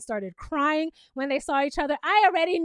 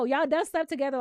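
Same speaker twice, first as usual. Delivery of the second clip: normal talking voice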